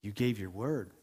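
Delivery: low, softened voice of gentle conviction; quiet and gentle. Acted. Holy Spirit repeating the reminder to a pastor, quiet and gentle. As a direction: let it land softly and low. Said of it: "spoke to me so quietly and so gently".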